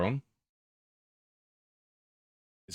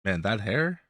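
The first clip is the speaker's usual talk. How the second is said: ordinary talk, nothing marked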